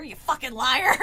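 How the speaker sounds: loud furious yell; screamed. Acted. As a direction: yell the insult at her. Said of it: "she screamed".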